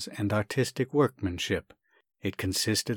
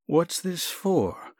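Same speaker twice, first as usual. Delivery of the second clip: slow, higher, puzzled frown; frowning doctor; 7 semitones higher, much slower than usual. Acted. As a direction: ask it slowly, suspicious of the gift.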